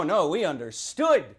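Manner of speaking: ordinary delivery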